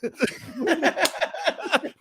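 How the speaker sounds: high bursting laugh; real high laugh. Real, not acted. Laughing at Mac and Me.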